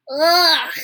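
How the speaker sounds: high, loud groan of disgust; disgusted ugh. A narrator's reading. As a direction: recoil with an ugh. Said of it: "said Cook".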